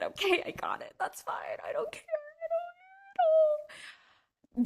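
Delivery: very high, hammed-up crying; mock tearful hurt. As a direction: pretend not to care while fake-crying. Acted hurt reaction to switching English.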